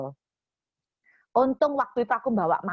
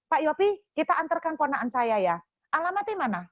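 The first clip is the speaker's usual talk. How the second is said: bossy demand; 6 semitones higher than usual. Retold or imitated. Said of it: "Ngomong ngambek".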